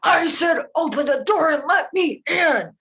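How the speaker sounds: high, loud bellow; furious big bad wolf insisting; angry wolf demand. A narrator's reading. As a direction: roar it out, leaning on every word. Wolf repeats his demand, angrier.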